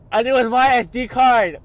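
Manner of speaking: real joy, voice leaping up; overjoyed. Real, not acted. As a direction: burst out in delighted disbelief. Finds lost SD card.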